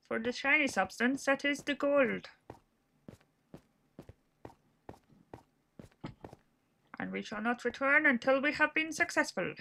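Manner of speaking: a hint of a pompous adventurer; lightly hammed-up epic narrator; grand quest voice. Gamer mum on her quest, mock-grand. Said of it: "I don't know why I'm putting on this voice".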